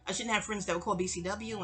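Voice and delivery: ordinary delivery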